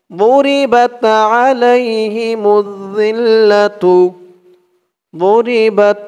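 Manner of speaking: slow, melodic tajweed chant of the verse; slow Quranic recitation. Shown as a demonstration. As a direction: chant it slowly, pulling out each vowel.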